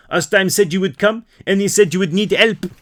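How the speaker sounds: smooth French charm; French accent. Acted. Torp greeting Herc in a French accent. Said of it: "I'm French now".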